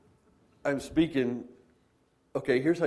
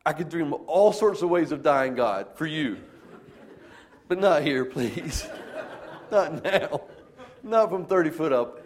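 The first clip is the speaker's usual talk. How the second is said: higher, louder, half-laughing plea; whiny scared prayer; 4 semitones higher, 4 dB louder than usual. Retold or imitated. Preacher stuck up high pleading with God, whiny and laughing. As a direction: beg God like a frightened kid, laughing at yourself. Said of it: "I sound like a whiny little kitty cat".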